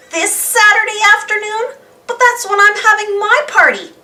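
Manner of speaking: put-on high, loud indignant girl voice; spoiled girl. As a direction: protest in a put-on girlish whine. Muffy indignant at clash.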